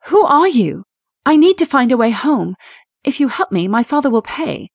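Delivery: a scared woman bargaining with a creature; frightened plea. Acted. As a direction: force the words out past fear. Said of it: "Juliet struggled to claim her voice".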